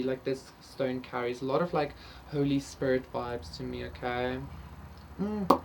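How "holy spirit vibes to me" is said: ordinary talk, nothing marked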